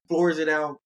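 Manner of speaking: usual speaking voice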